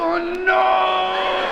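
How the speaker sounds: very high, long wailing cry; hammed-up wail, held long; comic anguish. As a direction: howl it high in mock agony. Acts out itch sufferer again.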